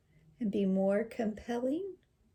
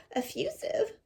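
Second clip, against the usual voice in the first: put-on little girl; 13 semitones higher than usual. Girl asks word meaning.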